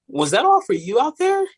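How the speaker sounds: high-pitched imitation of a stunned judge; high, swooping astonishment; amazed question. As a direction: ask it in wide-eyed disbelief. Retold judge, big-eyed, amazed.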